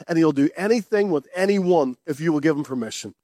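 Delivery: ordinary talk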